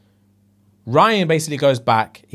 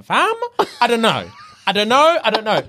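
flustered dithering; 8 semitones higher than usual. Retold or imitated. Retold panicked indecision.

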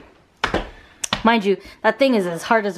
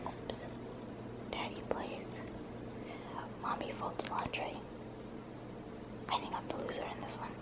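hushed whisper, voice off; 25 dB quieter than usual. Real, not acted. Baby asleep, whispering aside.

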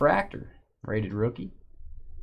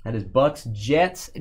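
ordinary talk

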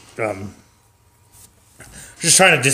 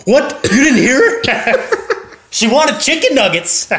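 much higher, louder mock yell; yelling husband; 12 semitones higher, 3 dB louder, slower than usual. Husband yelling at the cashier, hammed-up mock yell. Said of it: "She yells".

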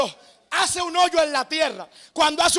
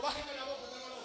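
almost inaudible, very soft; hushed; 3 semitones lower, 22 dB quieter than usual. Acted. God hushing Joshua, very soft and hushed. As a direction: drop to a near-silent hush.

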